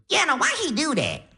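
very high, squeaky comic puzzlement; Cat Williams. Retold or imitated. Grandma to grandson about a celebrity, squeaky Def Jam-style imitation. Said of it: "she was talking in a crazy accent, like, uh, like Def Jam comedy, like Cat Williams".

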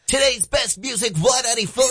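high sung station-ID jingle, a little hammed-up; mock radio jingle. Radio host mocking the sister station with a sung jingle. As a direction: sing it like a cheesy radio promo. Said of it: "'cause they go".

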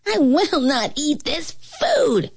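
put-on high, slow childish refusal; a spoiled little king digging in his heels; petulant boy-king voice, drawn out; stubborn young king. A narrator's reading. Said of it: "the king said".

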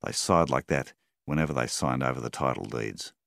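ordinary delivery

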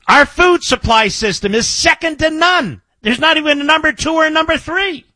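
high, impassioned shouted defence; real indignant outburst, high and forceful; heated insistence. Real, not acted. Host indignantly defends his country.

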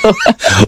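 a high, open laugh at the guest's story; host laughing at her son's joke; hearty laugh. Real, not acted. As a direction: laugh freely at the punchline.

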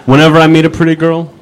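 loud first take of the setup. Shown as a demonstration. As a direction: punch the setup line out. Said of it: "like, whenever I meet".

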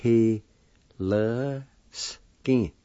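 slow first part, each sound held apart; syllable by syllable. Shown as a demonstration. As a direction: draw the syllables out with pauses. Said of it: "First part is pronounced like this".

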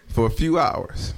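normal talking voice

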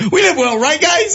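high, laughing, hammed-up pleading; talking to the puppies outside, high laughing plea; pleading with the dogs. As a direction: beg the puppies for love, laughing. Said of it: "I was like".

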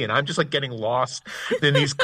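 ordinary talk, nothing marked

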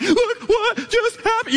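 squealed, slow, exaggerated sobbing words; very high hammed-up crying voice; mock bawling. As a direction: try to get it out through pretend sobs. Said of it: "can't even talk type crying".

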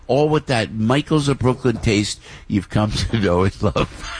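ordinary delivery

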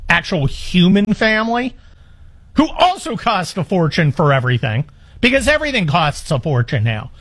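usual speaking voice

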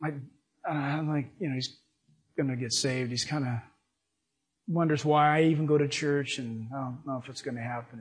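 quiet retelling. Retold or imitated. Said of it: "So she said".

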